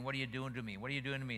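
ordinary talk, nothing marked

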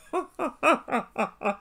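long run of laughter; rapid, amused ha-ha-ha; real laugh. Real, not acted. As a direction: crack up at the melodrama. Laughing at the dramatic quote.

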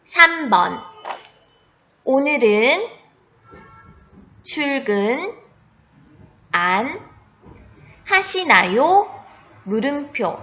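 spelling test read; dictating. Shown as a demonstration. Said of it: "문제는 딱 두 번 들려줄 테니까 잘 듣고".

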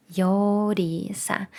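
Йоріса drawn out slowly; slow syllable read. Shown as a demonstration. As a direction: stretch each syllable of Йоріса. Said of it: "word demo".

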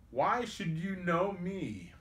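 high, slow, puzzled cry; cried question. A narrator's reading. Robin questions the stranger who knows his name, crying out. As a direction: call it out high and drawn out. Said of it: "cried Robin".

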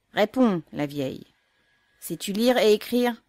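loud, high, insistent demand; officer repeating his shouted question to the mother. A narrator's reading. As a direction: press her hard for an answer. Officer demands answer again.

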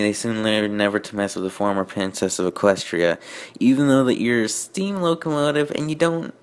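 ordinary delivery

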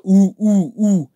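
lightly hooted ooh-ooh, a mild birdcall imitation; kookaburra ooh call. Imitating the kookaburra fart for viewers, mild hoots. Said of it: "then moves smoothly into".

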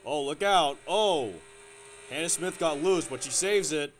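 sudden loud alarm as a car slides; excited. Real, not acted. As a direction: shout the near-spin out loud, then relief. Car gets loose mid-race.

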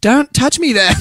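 put-on very high, drawn-out Australian accent; Australian girl. Host mimicking Australian girls correcting him, high Australian accent. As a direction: imitate an Aussie girl complaining, slow and high. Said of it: "Every Australian girl".

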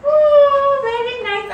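delighted praise. Real, not acted. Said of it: "Show me your happy faces".

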